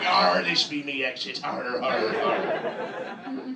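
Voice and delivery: hammed-up growling pirate. Eyeliner-wearing driver turned pirate after a pothole, hammed-up pirate voice. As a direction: play a cartoon pirate with drawn-out arrs. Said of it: "you're a fucking pirate".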